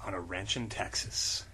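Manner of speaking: low, soft gravel of a tough hero; low, quiet tough-guy voice; gruff hero voice. Acted. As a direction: read it like a brooding action hero. Low dramatic reading.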